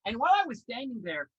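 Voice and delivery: usual speaking voice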